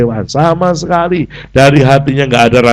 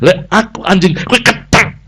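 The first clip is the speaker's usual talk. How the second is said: mock retort; 7 semitones higher than usual. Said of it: "Ngono bales-balesan".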